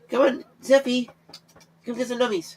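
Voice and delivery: high-pitched coaxing call; high pet call. Real, not acted. Calling his cat out from under the bed, high coaxing. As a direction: lure the cat out sweetly.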